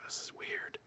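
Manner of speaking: barely audible whispered aside; uneasy whisper. Retold or imitated. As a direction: whisper it like an uneasy viewer reacting. Viewer's uneasy reaction, retold.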